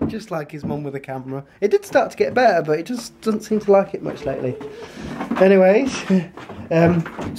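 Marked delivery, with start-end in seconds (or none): none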